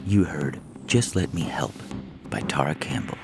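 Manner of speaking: plain reading voice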